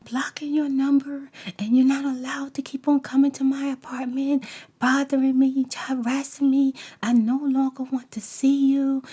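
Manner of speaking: mimicking the man listing his demands; mocking loud demand. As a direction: recite his rules back at him loudly.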